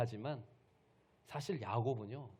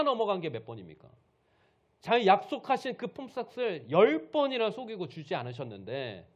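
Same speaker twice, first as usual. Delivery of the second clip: raised voice, high indignant outbursts; loud angry scolding; 6 semitones higher, 7 dB louder than usual. Acted. Jacob lists wrongs to his father-in-law, angry scolding. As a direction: confront him with each grievance, loudly. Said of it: "장인어른을 막 큰 소리 치면서 막 책망을 하는 거예요".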